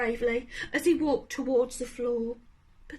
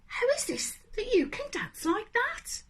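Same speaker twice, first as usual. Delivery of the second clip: higher eager begging; eager pleading; 8 semitones higher than usual. Acted. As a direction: plead to learn his secret. Animals pleading Gerald.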